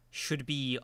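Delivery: ordinary delivery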